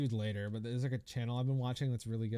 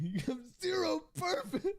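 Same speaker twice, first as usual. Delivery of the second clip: low tier god rant; 13 semitones higher than usual. Pretend. Chat asks [name] for the low tier god speech, so he delivers a mocking rant. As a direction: spit it like the streamer meme. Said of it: "your best low-tier god speech".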